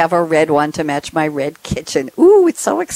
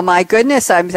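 ordinary talk, nothing marked

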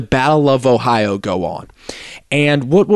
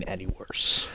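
much softer, almost voiceless aside; hushed repeat; 5 semitones lower, 14 dB quieter than usual. Real, not acted. Host underlining a bad debut to his co-host, hushed. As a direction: drop to a near-whisper for emphasis.

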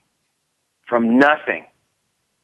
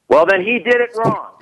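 much higher put-on voice, snippy; mock a creditor blaming the old boss; creditor's retort; 10 semitones higher than usual. Creditor voiced in retold call.

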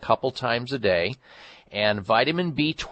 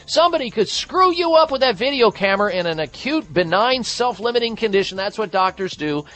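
heated rant; 10 semitones higher, 3 dB louder than usual. Real, not acted. Host ranting against mainstream doctors.

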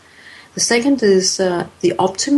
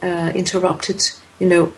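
ordinary talk, nothing marked

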